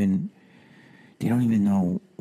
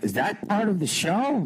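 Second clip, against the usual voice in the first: put-on higher puzzled kid voice; childlike question; 12 semitones higher than usual. Boy at circus puzzled by elephant, higher voice. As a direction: ask it as a baffled boy. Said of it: "And I'm like".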